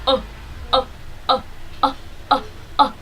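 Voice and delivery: clipped O, O said under the breath; breathy short O bursts from the belly; short vowel drill. Shown as a demonstration. Teacher drilling short vowels, breathy short O. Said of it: "Continue short vowel".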